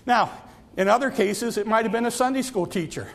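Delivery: ordinary delivery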